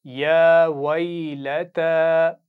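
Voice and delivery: drawn-out, melodic tajweed recitation; slow Quran recitation. Shown as a demonstration. Teacher reciting slowly for pupils to repeat. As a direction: chant each syllable slowly for the class to copy. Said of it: "एक दफ़ा फिर दोहराइए".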